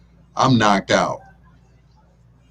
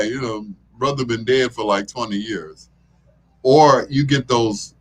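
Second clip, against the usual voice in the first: normal talking voice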